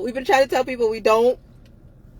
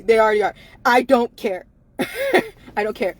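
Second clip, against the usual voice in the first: ordinary talk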